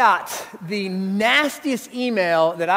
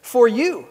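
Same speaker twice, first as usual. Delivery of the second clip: you pushed louder with a falling end; stress on YOU; 2 semitones higher, 4 dB louder than usual. Shown as a demonstration. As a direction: point it at the listener: For you. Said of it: "makes it real personal".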